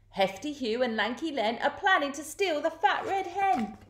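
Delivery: high, urgent ladybird voice; ladybird's alarm. A narrator's reading. Ladybird breaks alarming news.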